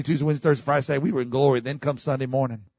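ordinary talk

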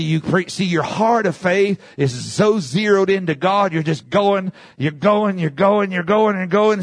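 ordinary talk

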